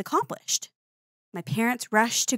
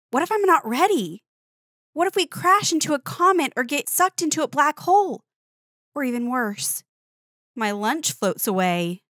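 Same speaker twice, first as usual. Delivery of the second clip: rising, worried voice, climbing higher and louder; higher, louder, anxious what-ifs; anxious child; 6 semitones higher, 3 dB louder than usual. Acted. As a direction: fret out loud like a nervous kid before launch. Child worrying before liftoff.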